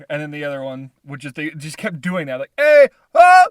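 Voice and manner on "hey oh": high, very loud back-and-forth hollers; mimicked shouted greetings. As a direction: mimic two guys calling across a lot. Retold calls back and forth.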